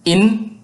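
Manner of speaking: In pitched high and clear; In stretched for learners; Serer word in. Shown as a demonstration. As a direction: model the Serer In, lifted. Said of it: "So repeat".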